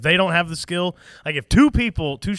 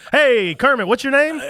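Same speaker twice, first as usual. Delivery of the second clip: cheery greeter; 9 semitones higher, 7 dB louder than usual. Retold or imitated. Acting out greeting a stranger.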